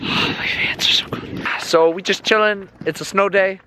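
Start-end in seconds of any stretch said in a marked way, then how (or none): 0.1-1.5 breathy whisper, slow. Real, not acted. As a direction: whisper it, shivering from cold. Cold hands on a snow day.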